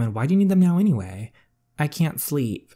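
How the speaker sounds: sharp and quick, annoyed; irritated. Acted. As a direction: snap back at your sister. Sisters argue at night.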